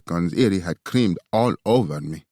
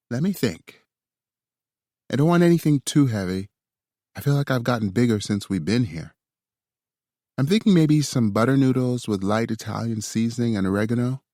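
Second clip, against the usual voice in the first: higher, lighter woman's voice; higher female voice; 6 semitones higher than usual. A narrator's reading. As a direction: voice her a little higher.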